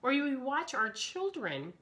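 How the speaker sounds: ordinary talk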